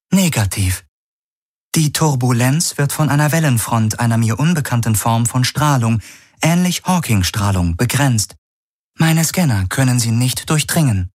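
high, neutral onboard computer reading out measurements; ship computer. Acted. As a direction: report data like a calm machine. Ship's computer reports.

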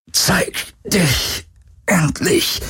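high, drawn-out challenge; strained call. A narrator's reading. Galen distracting the creature, high strained call. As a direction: call it out slowly through the effort. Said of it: "rief er".

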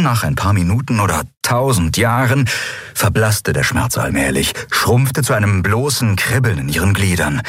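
plain reading voice

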